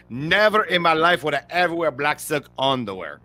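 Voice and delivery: put-on a light take on an action-star accent, barely shifted from his own voice; Jean-Claude Van Damme impression. Host quoting a Double Impact line, lightly imitated Van Damme voice. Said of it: "how he talks is more like in Double Impact".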